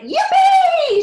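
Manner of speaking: very high, loud whoop; joyful shout. A narrator's reading. Grandpa Joe whooping as the lift shoots up. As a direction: whoop with glee. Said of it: "shouted Grandpa Joe".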